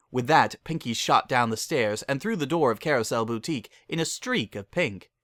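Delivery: ordinary delivery, nothing marked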